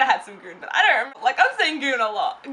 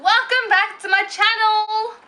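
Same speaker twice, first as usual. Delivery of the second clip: yelling; 7 semitones higher than usual. Pretend. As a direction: call it out, high and slow. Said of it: "I'm yelling".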